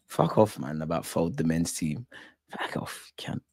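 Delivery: low, very soft grumble; muttered dismissal. Real, not acted. Fan brushing off talk of the men's team, muttered. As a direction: fade down to a near whisper.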